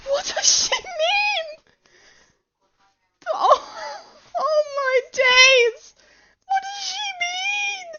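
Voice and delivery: high tearful squeal of panic; scared wailing. Real, not acted. Player can't grasp what she means, panicked wail. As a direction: squeal it, close to crying. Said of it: "I'm just scared".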